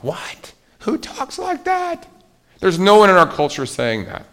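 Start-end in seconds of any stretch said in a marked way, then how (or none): 0.0-2.1 much higher, slow disbelieving question; incredulous. Real, not acted. Preacher marveling at Jesus' harsh words, incredulous. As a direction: sound amazed anyone speaks so.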